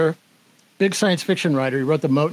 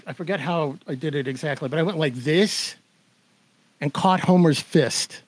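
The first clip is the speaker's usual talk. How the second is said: ordinary delivery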